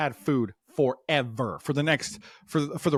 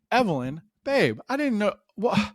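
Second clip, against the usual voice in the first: sweet probing dad; 8 semitones higher than usual. Retold or imitated. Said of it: "So I go".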